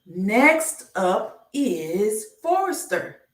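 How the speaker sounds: slow, stretched reveal; slow sing-song reveal; drawn-out announcement. Acted. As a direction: draw it out like revealing the next letter to kids. Slow game-show style letter reveal.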